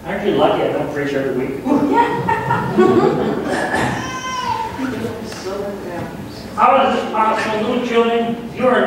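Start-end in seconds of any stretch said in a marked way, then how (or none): 0.1-1.6 quick, chuckling joke to the congregation; laughing aside. Real, not acted. 1.7-6.5 long laugh. Real, not acted. Laughs after joke.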